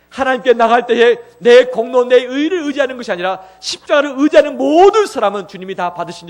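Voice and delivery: loud, high, impassioned preaching; preaching at full force. Acted. Preacher proclaiming the cross to his congregation, loud and impassioned. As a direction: proclaim it to the whole church.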